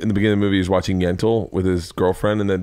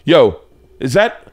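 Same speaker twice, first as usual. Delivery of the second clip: eager interruption; 5 semitones higher, 6 dB louder, slower than usual. Retold or imitated. Comic dad reacting to daughter's bit.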